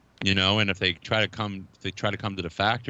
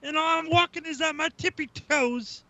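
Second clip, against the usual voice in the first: whiny robot voice. Retold or imitated. Voicing a toy robot mockingly.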